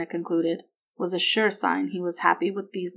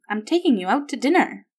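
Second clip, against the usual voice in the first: higher, cheery announcement; happily; 5 semitones higher, 4 dB louder than usual. A narrator's reading. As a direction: share a treat with a lilting lift. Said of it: "Margaret said happily".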